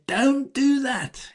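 high, slow indignant rebuke; indignant Jesus. Retold or imitated. Jesus rebukes disciples sending children away, indignant and slow. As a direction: stop them with sharp indignation. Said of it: "he's very indignant".

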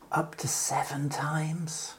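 Peter asks softly, in a breathy whisper; whispered question. Acted. Peter asking Jesus, whispering the question. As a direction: drop to a hushed whisper.

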